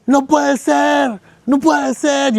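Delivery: high, stretched cry of disbelief; imitated shouting. Preacher acting out his friend yelling at his phone, shouted. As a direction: wail it out high, drawing out the words. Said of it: "Y empieza a gritar así".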